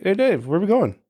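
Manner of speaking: high, singsong check-in; mock-casual call. Assistant catching the singer in the lobby, high mock-casual call. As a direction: call out lightly to someone caught sneaking off.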